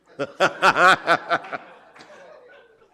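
big real burst of laughter; hearty laugh. Real, not acted. Joking about being happily divorced, hearty laugh. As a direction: laugh out loud at the joke.

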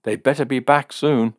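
high, loud warning; irritated ultimatum; glaring demand. A narrator's reading. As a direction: insist on it crossly. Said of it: "who was glaring at Fred".

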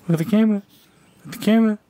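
high, sing-song cooing at the cat. Real, not acted. Owner coaxing her cat with treats, high baby talk.

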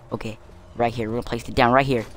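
ordinary delivery

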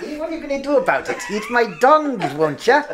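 fast, high mock Irish Leprechaun voice; Leprechaun Irish accent. Teasing friends with a high, fast Leprechaun Irish accent. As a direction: rattle it off like the horror-movie Leprechaun in a thick Irish accent. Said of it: "That, that accent".

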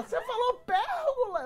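very high squealed insistence; hammed-up squeaky accusation; stoned wife. Stoned wife insisting husband said pergola, high mock squeal.

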